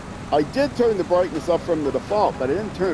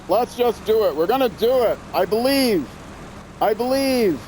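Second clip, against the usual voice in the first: psyched up; 7 semitones higher than usual. Real, not acted. Psyching himself up on bridge.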